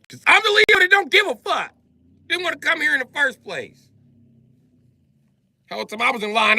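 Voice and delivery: high, loud defiant comeback; defiant Leo. Acted. Late Leo answering why he waited, high defiant voice. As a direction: toss it off like you don't care.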